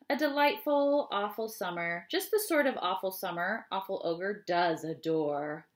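plain reading voice

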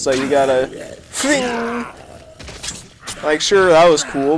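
normal talking voice

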